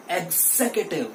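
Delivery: 'executive' as eg-ZEK-uh-tiv, stress on zek; right pronunciation. Shown as a demonstration. Teacher has students repeat, right pronunciation eg-ZEK-uh-tiv. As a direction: stress zek in 'executive', weak vowel after it. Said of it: "मेरे साथ बोलिए".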